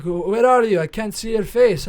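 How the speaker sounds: put-on loud, high Russian-accented doctor; imitated Russian accent, booming. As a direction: complain loudly as a Russian doctor. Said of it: "like, Russian accent".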